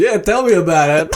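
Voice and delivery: ordinary talk, nothing marked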